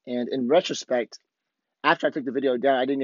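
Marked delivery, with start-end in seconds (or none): none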